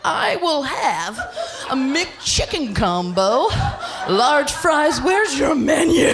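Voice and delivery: slow, swooping, high-pitched order; exaggeratedly deliberate fast-food order; stoned ordering. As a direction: draw out each item, oblivious. Stoned ordering at pharmacy counter.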